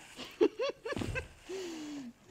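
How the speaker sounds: high, helpless laughter; real laugh. Real, not acted. Laughing at "how did he know", high real laugh. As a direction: laugh in a long high run that falls away.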